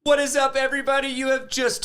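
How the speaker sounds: high, loud host greeting; hype show intro. Real, not acted. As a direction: kick off the show with energy. Podcast intro shout greeting.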